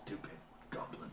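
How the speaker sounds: hushed, whispery insult; low-volume ogre grumble under the breath; contemptuous ogre. Acted. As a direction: drop to a disdainful whisper. Ogre's insult after the threat.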